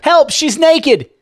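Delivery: very high, loud alarmed shout; child's yell. A narrator's reading. Child spots naked woman, high alarmed yell. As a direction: shriek for help like a scandalised child. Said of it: "yelled another".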